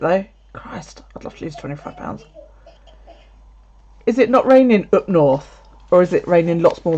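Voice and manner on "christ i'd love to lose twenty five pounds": soft unvoiced muttering to yourself; hushed reaction to a viewer's weight loss; whispered aside. Real, not acted. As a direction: breathe it under your breath.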